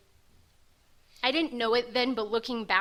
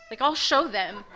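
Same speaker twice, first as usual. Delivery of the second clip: higher and louder, racing with spite; angry outburst; 4 semitones higher, 6 dB louder than usual. Retold or imitated. Vowing to get sober just to spite family, fast and angry. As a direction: spit out the vow fast and furious. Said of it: "but super angry".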